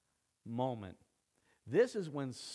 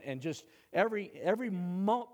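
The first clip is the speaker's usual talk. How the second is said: normal talking voice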